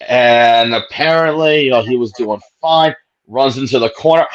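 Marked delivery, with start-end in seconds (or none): none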